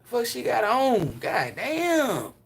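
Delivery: loud, high Memphis country holler; imitating loud Memphis street talk; gutter Memphis talk. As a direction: call out like the Memphis guys. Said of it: "That's how they be talking".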